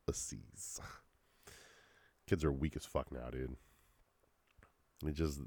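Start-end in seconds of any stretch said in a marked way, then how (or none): 0.1-0.8 soft voiceless whisper; hushed, whispered echo; whispered jab. Real, not acted. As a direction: whisper the insult as an afterthought.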